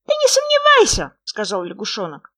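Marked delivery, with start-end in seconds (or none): 0.1-1.2 high, loud, squeaky little frog; small creature's high, loud voice. A narrator's reading. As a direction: pipe up eagerly as a tiny frog.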